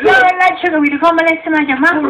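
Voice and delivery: loud, high, outraged protest; indignant shouting. Acted. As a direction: rant at him in disbelief. Heated comic argument, raised voice.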